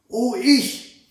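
high-pitched volunteering cry of the eldest daughter; eager call. A narrator's reading. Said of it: "rief die Älteste". (eifriger, hoher Ausruf)

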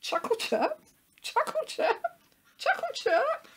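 very high squeaky repeats; tweeting falsetto chuckle-words; chuckling chirping bird. A narrator's reading. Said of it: "chuckling and chirping at the same time".

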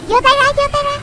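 ordinary talk

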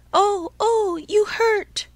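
high, loud little voice crying in pain; tiny wooden voice crying out. Acted. The log cries out as the carpenter strikes it, high and loud. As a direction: yelp it out like a tiny hurt puppet. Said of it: "cried the same faraway little voice".

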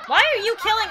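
high, loud challenge; indignant protest. Real, not acted. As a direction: demand an answer from a killer. Protesting the killing of Senpai.

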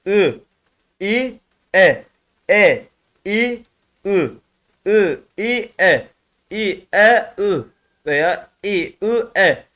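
short syllables E, Ü, İ called out in mixed order; mixed vowel drill. Shown as a demonstration. Teacher reading mixed vowels for students, crisp calls. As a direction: call each vowel crisply with pauses. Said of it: "Bu şekilde karışık da okuyabilirsiniz".

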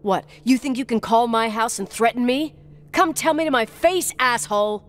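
indignant and heated; angry retort. Acted. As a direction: dare the caller to face you, voice climbing. Angry retort to threatening caller.

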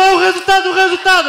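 high, loud repeated insistence; excited insistence. Acted. [name] insisting he has won.

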